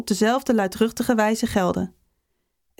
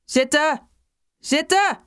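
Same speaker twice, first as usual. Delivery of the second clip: high, exasperated teacher's order; called-out command; 5 semitones higher, slower than usual. A narrator's reading. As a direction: call the class to order, pitch climbing. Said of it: "riep de fluit". (hoog geroepen bevel)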